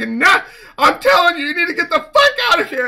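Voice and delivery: high, loud, fast yell; mock shouting friend. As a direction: shout him away, quick and angry. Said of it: "And he's like".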